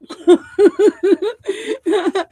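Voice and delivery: a high, loud burst of laughter; high bright laugh. Real, not acted. Reader to the artist after good news, laughing high.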